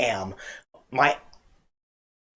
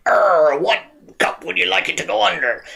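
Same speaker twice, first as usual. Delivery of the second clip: growled arr, then high pirate voice; hammed-up pirate accent; 5 semitones higher, 4 dB louder than usual. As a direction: ask it like a stagey pirate. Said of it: "Next it'll be a pirate".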